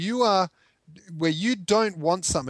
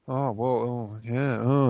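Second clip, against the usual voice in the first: flustered cringing; 5 semitones lower, slower than usual. Retold or imitated. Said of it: "and they cringe".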